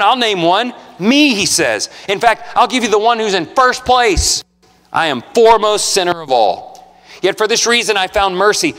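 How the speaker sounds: rising preacher's cry of scripture; preaching at full force. Acted. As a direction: proclaim it to the whole room. Preaching Paul's words at full force.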